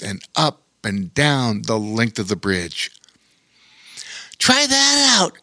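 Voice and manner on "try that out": much higher little spider, proud and polite; a tiny spider introducing himself; friendly spider voice. Acted. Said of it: "said a panting Darwin".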